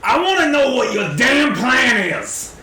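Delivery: hammed-up angry interrogation; confrontational adventurer. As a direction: challenge a cornered archer in character. Player confronts archer in character.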